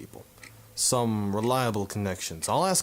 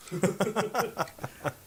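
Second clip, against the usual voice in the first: a short high chuckle; light laugh; 10 semitones higher than usual. Real, not acted. Teasing an old army friend, short high chuckle. Laughs at own smoking joke.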